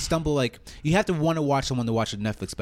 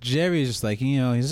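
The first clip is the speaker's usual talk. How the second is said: normal talking voice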